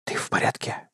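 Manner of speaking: halting whisper; broken whisper. Acted. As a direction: whisper it, breaking off. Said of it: "прошептал я, прерываясь".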